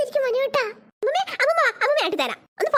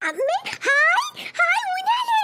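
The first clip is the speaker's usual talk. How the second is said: excited squeal; 7 semitones higher, much slower than usual. Acted. Child thrilled at new swing.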